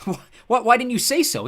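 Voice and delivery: high, loud, stammered burst; shaky, stammering excitement. A narrator's reading. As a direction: blurt it out with stammering disbelief. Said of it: "I let out a shaky laugh".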